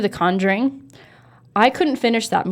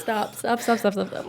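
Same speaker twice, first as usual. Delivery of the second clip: nervous, softened pleading with laughter; half-laughing real unease; freaked out; 7 dB quieter than usual. Real, not acted. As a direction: beg them to stop, rapid repeats. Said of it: "getting freaked out just thinking about it".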